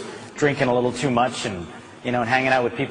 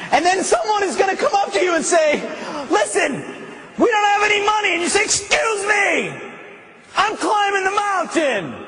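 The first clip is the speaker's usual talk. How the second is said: preaching at full force. Acted. Speaker rallies the audience toward the big finish, full-force preaching. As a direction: drive the crowd to the climax. Building sermon climax.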